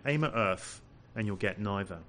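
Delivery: ordinary talk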